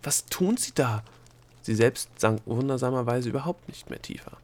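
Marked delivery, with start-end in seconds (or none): 0.0-1.0 slow, raised, desperate question. A narrator's reading. Delia questions the plunging dwarfs, desperately. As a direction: draw it out in dismay. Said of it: "rief Delia verzweifelt".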